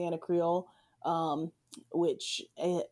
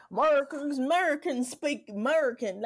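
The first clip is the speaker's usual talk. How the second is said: "Americans" slurred into "'Murricans", pitched up; mock American twang; 7 semitones higher than usual. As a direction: parody a patriotic yokel accent, swallowing the first A of "American". Mimics English-only slogan, clipped to 'Merican.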